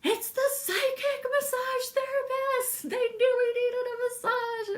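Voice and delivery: very high, squealing mock excitement; hammed-up high-pitched impression of a delighted caller; imitating cracked-up delight. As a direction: squeal it like someone cracking up on the phone. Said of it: "She just cracked up".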